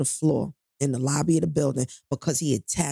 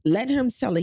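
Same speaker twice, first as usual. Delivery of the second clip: normal talking voice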